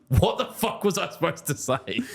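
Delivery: high, helpless laughing protest. Real, not acted. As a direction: protest through laughter, pitch shooting up. Laughing at his accidental slur.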